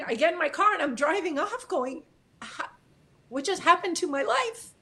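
halting, upset telling; real tearfulness. Real, not acted. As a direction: retell it while fighting tears. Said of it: "I'm crying".

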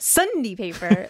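Sunday with a punched SUN and reduced "dee"; mimicking dad's "Sunday paper". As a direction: make Sunday jump out of Sunday paper. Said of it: "It's the Monday paper".

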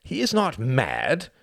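slow insistent "He is not mad" with "is" stressed and rising; Reginald, insistent. Acted. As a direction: lean into IS with a rising tune, slowly. Reginald pleads at the meeting.

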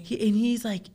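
ordinary talk

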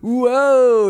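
mock villain awe. Imhotep showing off his powers, mock awed whoa. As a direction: play the villain marvelling at his sand powers. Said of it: "he's like".

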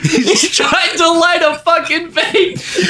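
wheezing with laughter, slowed; helpless laughter. Real, not acted. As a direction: barely get it out through laughter. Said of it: "laugh cry".

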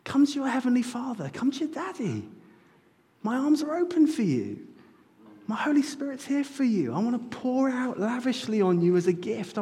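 high, open, welcoming voice of God; God speaking as a tender father; tender fatherly invitation. Acted. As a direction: call them home gently like a loving dad. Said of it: "then says".

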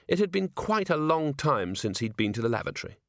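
usual speaking voice

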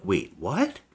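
baffled viewer, pitch shooting up on the last word; mock bafflement. Viewer confused by a convoluted thriller plot, mock bafflement. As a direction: act lost, ending on a high squeaked question.

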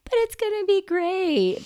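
a bright, breezy reassurer; chirpy optimist. Retold or imitated. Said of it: "so easy for me to be like".

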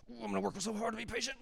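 high, soft mocking whine of effort; mock strained resolve. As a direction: overdo the straining like a parody. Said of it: "your takeaway from this message is".

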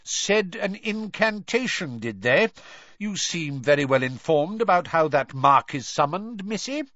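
sceptical, needling questioning, lifting at the ends; disbelieving. Acted. Mister Crouch interrogating Hermione, disbelieving. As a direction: cast doubt with sarcastic suspicion. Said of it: "Disbelief etched all over his face".

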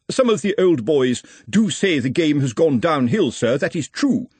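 stiff, high-pitched formal address; awkward public speaker Ottomy. Acted. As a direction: address the gents with laboured formality.

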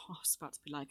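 fast breathy whisper after a sigh; whispered aside. Real, not acted. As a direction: mutter it to yourself, fast. Self-mocking aside after sigh.

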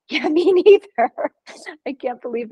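nervous laughter after a close call; laughing. Real, not acted. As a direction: laugh off the shock. Laughing after near-accident.

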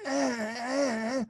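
high strained grunts through a throat tube; desperate grunting. Retold or imitated. Patient on a ventilator grunting urgently at the doctor.

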